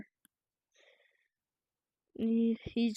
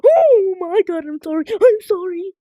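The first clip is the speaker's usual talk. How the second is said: terrified horror victim; 3 semitones higher, 9 dB louder than usual. Pretend. Pleading with horror game monster.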